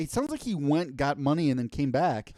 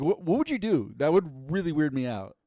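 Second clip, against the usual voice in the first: ordinary talk, nothing marked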